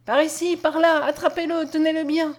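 high, urgent pursuit cries; narrated crowd yelling. A narrator's reading. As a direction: shout orders like a mob in chase. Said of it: "hurlaient ensemble dix mille voix".

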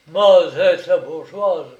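loud, impressed teasing; mock admiration. A narrator's reading. Brigadier ribbing the peasant woman, mockingly impressed. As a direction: exclaim with hearty irony. Brigadier mocks her claim.

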